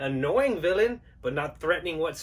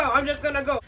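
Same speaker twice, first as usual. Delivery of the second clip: sheepish exit repeated; 6 semitones higher, faster than usual. Acted. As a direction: trail off as you back away. Collector trying to leave.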